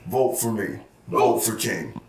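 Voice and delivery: deep, heavy campaign tagline; deep slogan voice. Acted. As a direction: drop way low for the slogan. Campaign slogan closing the speech.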